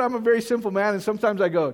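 ordinary talk

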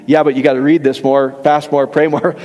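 a low, preachy put-down of the believer; mock legalist. Mocking legalistic church teaching.

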